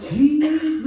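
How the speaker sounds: high, loud, slow awe with a laugh; awed exclamation. Retold or imitated. Priest marvelling at the full ciborium, high and loud. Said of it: "now I'm like".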